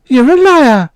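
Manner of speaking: high, loud shout back; furious child. Acted. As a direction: hurl it back at the bully.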